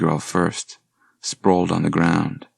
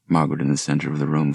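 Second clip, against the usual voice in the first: usual speaking voice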